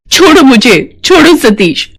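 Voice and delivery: alarmed, high-pitched plea; struggling protest. Acted. Nurse struggling free from the doctor, alarmed.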